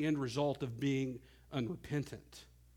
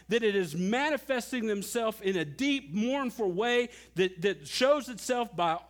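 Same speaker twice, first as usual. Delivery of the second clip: higher, louder pleading preacher; impassioned preaching; 7 semitones higher, 4 dB louder than usual. Acted. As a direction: drive the question home from the pulpit. Preaches at full force.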